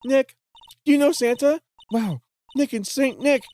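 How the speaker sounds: high, squeaky cartoon voice. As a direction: pitch way up, squeaky. Same high put-on voice.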